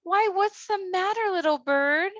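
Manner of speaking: put-on tiny girl caring for a hurt bird; little girl voice. Thumbelina asks the sick bird.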